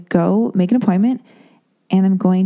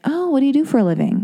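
higher, quicker, overly chatty doctor; nosy doctor; 8 semitones higher, faster than usual. Retold or imitated. The doctor quizzing her about her job, higher and quicker. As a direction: play the prying doctor lightly.

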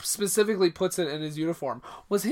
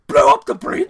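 high, loud incredulous cry; shocked disbelief; 10 dB louder than usual. Retold or imitated. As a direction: Nicholson stunned at the plan. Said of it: "He's like".

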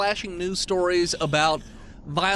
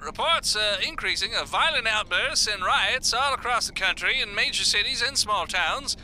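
steady radio news read; news report. Acted. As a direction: read it like a broadcast.

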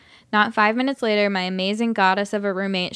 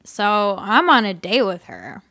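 put-on drunk voice. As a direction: play a sloppy drunk guy. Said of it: "I'll just do my drunk voice".

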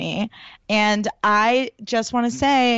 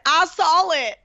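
put-on higher, twangy Southern country accent; Brittany's accent; 8 semitones higher, slower than usual. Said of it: "You're so good at Brittany's accent".

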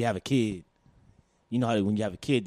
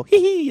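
giddy giggle; 5 dB louder than usual. Retold or imitated. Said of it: "I go".

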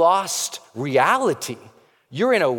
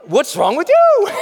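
higher, louder, slower, with laughter; laughing comeback; 14 semitones higher, 5 dB louder than usual. Retold or imitated. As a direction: fire it back, amused and defiant. You answer them back laughing.

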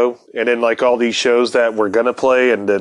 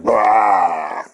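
mock retching shout; 2 semitones lower than usual. Retelling friend puking on stage.

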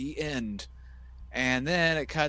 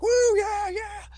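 much higher hooting woo from leering fans; creepy crowd cheer; 7 dB louder, slower than usual. Retold or imitated. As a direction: whoop it up like a sleazy fan in the stands. Horny guys in audience cheering.

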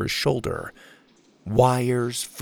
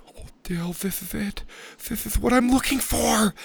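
awed whisper; 11 semitones higher, 3 dB quieter than usual. Acted. Max awed at crystal.

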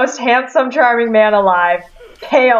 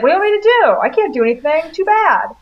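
higher, whiny dismissive shrug of a voice; mock whiny husband; 7 semitones higher, faster than usual. Mocking the husband who won't say no to his boss, higher and whiny. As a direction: mimic him brushing it off, pitched up and petulant. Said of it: "he's really just like".

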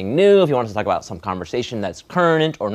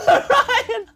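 high laughing call; 4 dB louder than usual. Acted. S1 calling out in bit.

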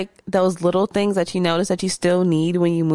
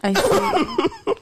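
high, laughing. Real, not acted. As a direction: say it mid-laugh. Laughing at lonely story.

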